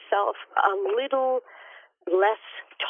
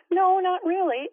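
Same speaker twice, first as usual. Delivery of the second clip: imitating her son's calm, offhand reply, higher; a mock deadpan no, pitched up; 11 semitones higher than usual. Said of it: "he just very calmly said".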